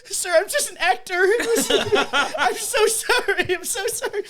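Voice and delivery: high, laughing, pleading voice; panicked apology. Retold or imitated. Actor begging the angry sergeant for mercy, panicked and squeaky.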